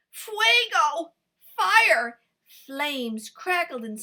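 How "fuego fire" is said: alarmed shout. Acted. Fox sees flames outside den.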